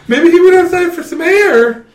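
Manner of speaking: high, bright voice for the clumsy twin sister; a light falsetto impression of Jill; mock Jill voice. Retelling a film scene as Jill.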